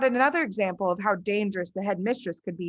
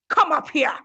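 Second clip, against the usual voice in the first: higher, louder command; shouted order; 7 semitones higher, 5 dB louder than usual. Acted. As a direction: shout him up to the stage. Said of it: "the Trunchbull shouted".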